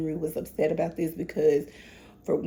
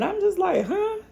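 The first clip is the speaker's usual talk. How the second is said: high, pitched-up baffled huh; 12 semitones higher than usual. Real, not acted. Viewer reacting to the lifeguards, baffled and high. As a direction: sound truly thrown by it.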